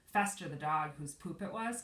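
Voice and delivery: ordinary delivery, nothing marked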